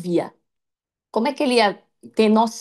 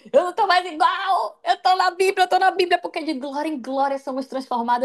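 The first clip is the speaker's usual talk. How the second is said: higher, bright triumphant joy; joyful exclamation; 8 semitones higher than usual. Retold or imitated. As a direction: rejoice at who you've become. Joyful self-quote before mirror. (exclamação alegre, mais aguda)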